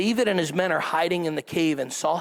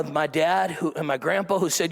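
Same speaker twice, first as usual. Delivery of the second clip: ordinary talk, nothing marked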